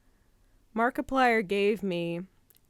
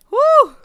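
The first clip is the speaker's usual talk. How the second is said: emotional whoop; 17 dB louder than usual. Real, not acted. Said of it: "Let me compose myself".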